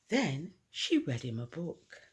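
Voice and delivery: ordinary delivery, nothing marked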